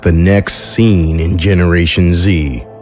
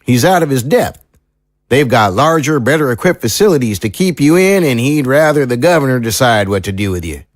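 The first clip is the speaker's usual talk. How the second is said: country lawman, hesitant then frank; folksy small-town sheriff, confiding; 7 semitones higher, faster than usual. Acted. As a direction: play a sheriff sharing what he shouldn't. Sheriff figure confides a plan.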